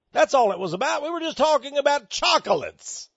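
high-pitched mock innocence; fake slimy smile. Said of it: "put on a little fake slimy smile".

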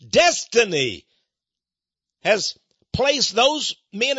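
loud, high, drawn-out exhortation; full-force preaching. Acted. Preacher reassures those who feel like failures, preaching at full force. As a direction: preach it slowly with soaring force.

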